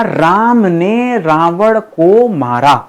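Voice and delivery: slow dictation pace; slow example. Shown as a demonstration. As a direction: spell it out slowly, word by word. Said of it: "ध्यान सुनिएगा". (धीमी गति से बोला गया उदाहरण)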